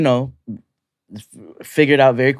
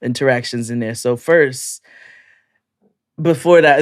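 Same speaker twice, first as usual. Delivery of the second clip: ordinary talk, nothing marked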